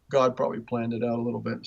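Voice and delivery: ordinary talk, nothing marked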